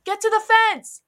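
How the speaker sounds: high, loud shouted order; urgent yell. A narrator's reading. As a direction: yell it to get everyone running. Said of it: "Aiden yelled".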